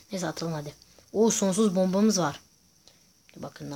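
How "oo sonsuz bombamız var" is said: normal talking voice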